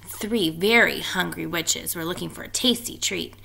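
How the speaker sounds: plain reading voice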